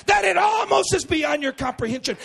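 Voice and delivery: high, loud preaching shout, slowing on inconceivable; preaching at full force. Real, not acted. Pastor stirred in the Holy Ghost, shouting. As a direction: pour it out to the room.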